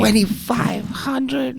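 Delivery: very high, drawn-out disbelief; incredulous squeal. Real, not acted. Co-host repeating the stud fee, incredulous squeal. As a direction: squeak the figure out slowly, astonished.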